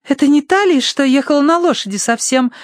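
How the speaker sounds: put-on high-pitched questioning girl's voice; Tossie's voice. A narrator's reading. Tossie asks about the lady on the horse, high voice.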